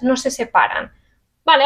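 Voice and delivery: normal talking voice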